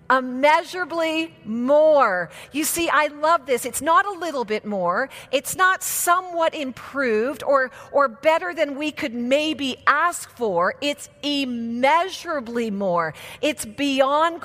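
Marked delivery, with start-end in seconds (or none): 0.1-2.3 "immeasurably more" slow and loud; stress on immeasurably more. Shown as a demonstration. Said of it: "say this". 10.8-13.1 "immeasurably" slow and weighty; stressed. Shown as a demonstration. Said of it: "repeats phrase with emphasis".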